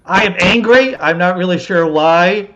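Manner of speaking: half-joking burst of anger; angry. Real, not acted. Cohost opening the morning show, loud mock-angry outburst. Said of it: "I'm just angry".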